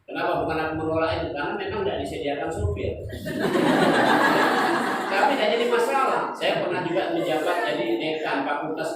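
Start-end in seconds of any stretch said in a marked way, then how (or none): 3.0-5.1 loud wheezy burst of laughing; hearty laugh. Real, not acted. Director joking to audience about having no driver, hearty laugh. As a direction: laugh hard at your own joke.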